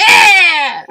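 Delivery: loud high yeah; excited shout. Real, not acted. Celebrating marble up the nose with a shout. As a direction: cheer it out.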